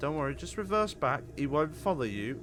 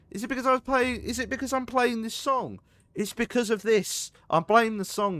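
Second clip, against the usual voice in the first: high indignant; 10 semitones higher, 4 dB louder than usual. Real, not acted. Blaming the song.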